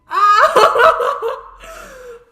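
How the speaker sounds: high, loud laugh; real long burst of high laughter. Real, not acted. Viewer delighted by ghost Jackie's taunt, high loud laugh.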